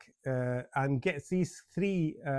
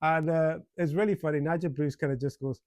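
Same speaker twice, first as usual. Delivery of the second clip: ordinary talk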